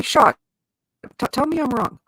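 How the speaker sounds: usual speaking voice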